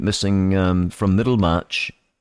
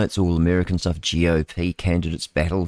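normal talking voice

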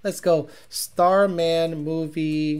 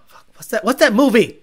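usual speaking voice

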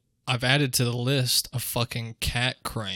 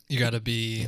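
ordinary talk

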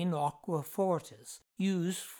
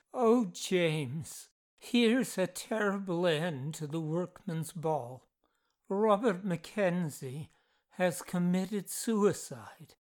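ordinary delivery

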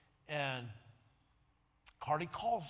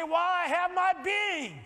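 higher, louder call line; call to repeat; 11 semitones higher, 10 dB louder than usual. Shown as a demonstration. Preacher leading the congregation to repeat loudly. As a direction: belt it for the crowd to echo. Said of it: "Everybody say".

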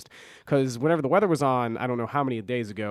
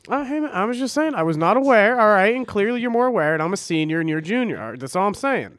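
playfully defensive, raised voice; mock defensive; 8 semitones higher, 7 dB louder than usual. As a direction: act huffy and defensive. Mock defensive retort to co-host.

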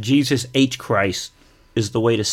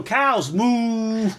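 excited cows call; 8 semitones higher than usual. Retold or imitated. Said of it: "I go".

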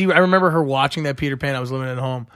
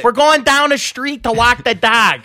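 broad Wilkes-Barre accent, higher and louder; hammed-up Wilkes-Barre local; exaggerated Pennsylvania Wilkes-Barre accent; 8 semitones higher, 6 dB louder than usual. Shown as a demonstration. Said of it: "that's how they fucking sound".

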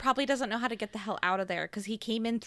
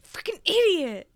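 mock self-scolding; 8 semitones higher, 5 dB louder than usual.

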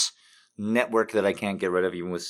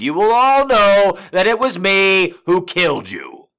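much higher, much louder slow roar; villain shout; 12 semitones higher, 12 dB louder, slower than usual. Pretend. Streamer joking as a villain, triumphant roar. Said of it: "did I reveal too much".

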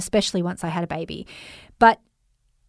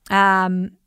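ordinary talk, nothing marked

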